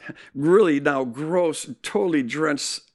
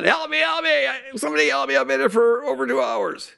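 higher, imitating a desperate patient calling out; mock hollered plea; 9 semitones higher than usual. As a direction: wail it like a man begging for help in the ER. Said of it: "the guy hollers out again".

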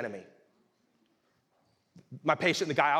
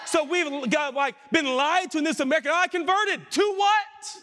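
much higher and louder fiery preaching; preaching at full force; 12 semitones higher, 5 dB louder than usual. Acted. Preacher rails at the congregation about empty conversion, fiery shout. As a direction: hammer the point home to the back row.

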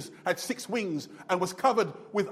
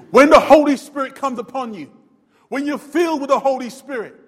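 much louder, driving the point home; loud emphatic restatement; 3 semitones higher, 10 dB louder than usual. Real, not acted. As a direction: preach it again at full volume, hammering it in. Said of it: "Let me say that again".

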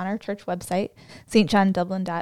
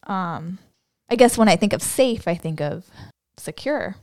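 ordinary delivery

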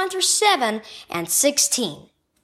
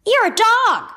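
high, loud guess called out; excited shout; 10 semitones higher, 5 dB louder, slower than usual. A narrator's reading. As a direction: shout the answer out, pitched high. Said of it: "Parker shouted".

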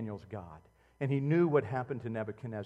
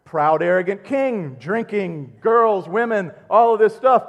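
slow, then rising louder and higher to a shout; building preaching fervour; 6 semitones higher, 14 dB louder than usual. Acted. Preacher denouncing the arrogant king, building to a shout. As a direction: build from slow scorn to a roar.

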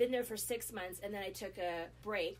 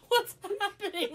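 bewildered; 12 semitones higher, 11 dB louder than usual. Retold or imitated. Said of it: "I'm like".

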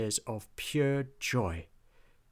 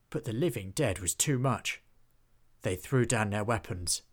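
plain reading voice

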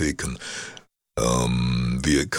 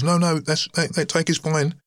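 high-pitched imitation of an engineer; lightly mocking high-voiced technician; engineer's voice; 14 semitones higher than usual. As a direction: quote him in a high, put-on voice. Engineer voiced as reassuring him.

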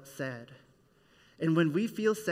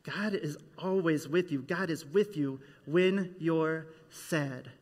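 ordinary talk

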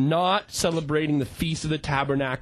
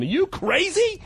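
high, rising mock outrage; hammed-up Paul losing patience; incredulous; 13 semitones higher than usual. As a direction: throw it at them in disbelief. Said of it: "He was so upset".

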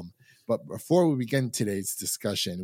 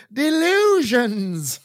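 much higher, very slow hammed-up drawl on Delusions; mock Southern drawl; 12 semitones higher, 7 dB louder, much slower than usual. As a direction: stretch Delusions in a silly twang. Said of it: "Is that better for you?".

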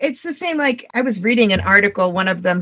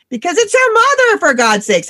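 exasperated outburst; 8 semitones higher, 4 dB louder than usual. Real, not acted. Exasperated about facing your mother.

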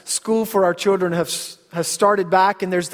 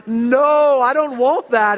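higher, drawn-out comic refusal; mock protest; 6 semitones higher, 4 dB louder, slower than usual. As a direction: protest like an overwrought dad. Father's acted protest at junior high.

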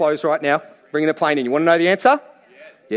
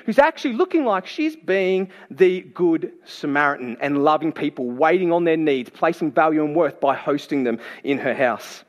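ordinary talk

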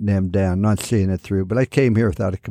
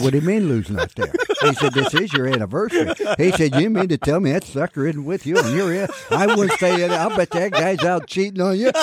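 rising disbelief, pitch climbing higher line by line; mock outrage building toward a joke; incredulous indignation; 8 semitones higher than usual. Retelling Bob Hope scolding the wife, mounting mock disbelief.

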